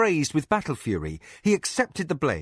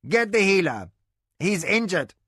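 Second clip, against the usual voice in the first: raised urgent command; urgent order; 6 semitones higher than usual. A narrator's reading. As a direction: call for the healer.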